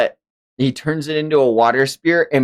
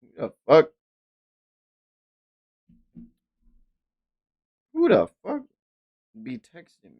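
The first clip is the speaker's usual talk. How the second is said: startled; 4 semitones higher, 3 dB quieter than usual. Real, not acted. Surprised by a text.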